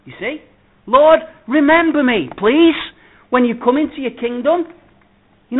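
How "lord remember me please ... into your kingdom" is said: loud pleading cry. Retold or imitated. Thief's plea quoted loudly.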